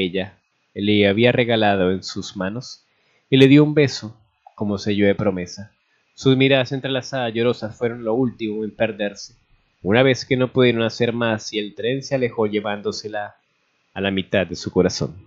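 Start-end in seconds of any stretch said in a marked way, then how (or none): none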